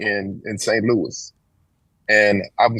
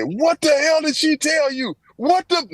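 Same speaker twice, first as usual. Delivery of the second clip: shrill mock outrage, much higher and slow; imitating furious mother; slower than usual. Mother grills her son about the teacher, high mock outrage. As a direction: squeal it out in disbelief. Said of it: "she went crazy".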